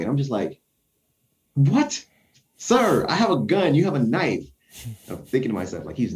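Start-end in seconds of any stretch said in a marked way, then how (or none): 1.5-4.4 high disbelieving what, sir; can't believe he brought a knife; incredulous. Retold or imitated. Baffled that a man pulls a knife on his gun, high and incredulous.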